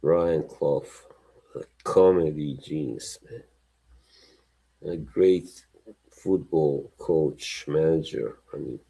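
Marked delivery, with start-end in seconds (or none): none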